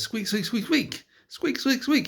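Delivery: tiny falsetto mouse voice; mouse squeaks. Acted. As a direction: squeak very high like a little mouse. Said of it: "And then Todd says".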